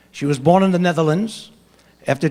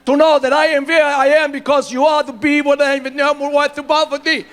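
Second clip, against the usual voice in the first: put-on Austrian accent, mangled English, higher pitch; mock Arnold Schwarzenegger with a thick Austrian accent; Schwarzenegger impression; 9 semitones higher than usual. Comic retelling to the audience, in a mock Austrian accent. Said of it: "I says, "Arnold".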